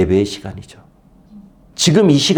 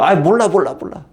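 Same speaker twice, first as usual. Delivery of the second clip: sulky whine; 6 semitones higher than usual. Retold or imitated. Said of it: "지금 아브라함 딱 이거예요".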